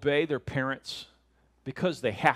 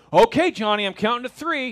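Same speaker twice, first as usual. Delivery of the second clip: loud, higher mock warning; fed-up parent; 6 semitones higher, 7 dB louder than usual. Parent warning Johnny of a count, mock fed up. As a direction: announce the last chance, exasperated. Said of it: "the parents that are like".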